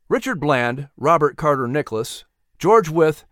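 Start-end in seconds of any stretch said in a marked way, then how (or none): none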